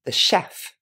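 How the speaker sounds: "chef" pronounced shef, never chef with tch; French chef. Shown as a demonstration. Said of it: "gardé la prononciation à la française".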